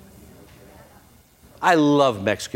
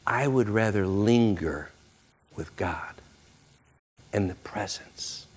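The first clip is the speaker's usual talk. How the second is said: quiet devotion; 5 semitones lower, 6 dB quieter than usual. Acted. Joshua's quiet choice.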